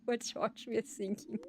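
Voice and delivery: "thinking" said as "sinking", through laughter; mispronounced th. Retold or imitated. Mimicking colleagues' bad English, "thinking" with an s. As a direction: say "thinking" with an s, amused. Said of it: "You're just pronouncing thinking".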